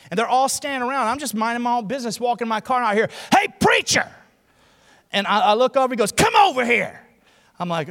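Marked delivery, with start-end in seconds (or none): 3.3-4.1 high, loud taunting holler; bully hailing his target; imitated call-out. Imitated bully calling across lot. 6.1-7.0 loud, raised bully's order; hollered command; imitated summons.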